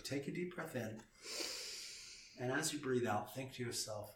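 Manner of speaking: soft and quiet, leading a breath in; calm guided breath cue. Shown as a demonstration. Host guiding cohost through a calming breath, softly. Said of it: "let's, let's do this correctly".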